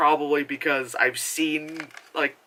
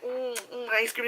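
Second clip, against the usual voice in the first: higher, softer sultry purr; mock sultry; 7 semitones higher, 7 dB quieter than usual. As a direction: tease the ice cream seductively. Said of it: "she's like".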